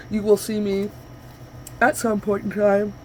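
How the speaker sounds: speaking mid-yawn. Real, not acted. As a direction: let a yawn pull the words. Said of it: "There's another yawn".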